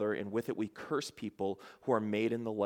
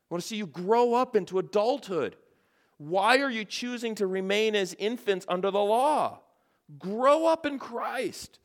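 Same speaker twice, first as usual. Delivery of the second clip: higher, louder pleading like a mother in anguish; 8 semitones higher, 4 dB louder than usual. Acted. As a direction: beg them to grow up, rising and urgent. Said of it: "he changes his tone".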